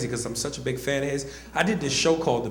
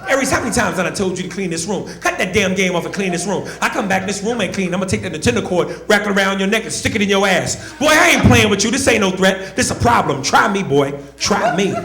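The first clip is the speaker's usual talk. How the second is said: loud, raised-pitch threats from a fed-up mom; mother's angry scolding; 5 semitones higher, 5 dB louder than usual. Retold or imitated. Mother cussing out her son over his messy room, angry scolding. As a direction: lay down the law, loud and rapid-fire. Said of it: "cuss me out for no reason".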